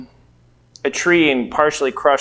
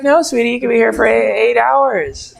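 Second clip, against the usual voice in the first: lightly voiced sweet older lady, higher; sweet examiner; 6 semitones higher than usual. Test lady reassuring him about the time, light higher voice. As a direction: play the kindly test lady. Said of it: "She's like".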